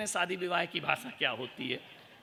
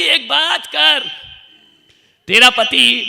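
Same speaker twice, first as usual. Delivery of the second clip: much louder, slow, drawn out; loud mock call; 6 semitones higher, 17 dB louder, slower than usual. Monk loudly questioning the wife about her husband. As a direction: boom it across the hall.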